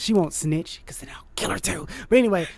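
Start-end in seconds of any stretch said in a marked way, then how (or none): 0.9-1.9 conspiratorial whisper; a secret threat, whispered to the listener; whispered aside. Acted.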